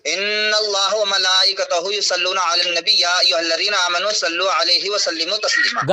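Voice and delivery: slow, drawn-out Arabic recitation; measured, low-pitched scripture reading; Quran recitation. Real, not acted.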